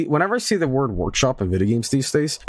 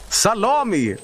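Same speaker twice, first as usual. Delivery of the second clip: teasing call; 8 semitones higher, slower than usual. Pretend. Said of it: "teasing the dog on stream".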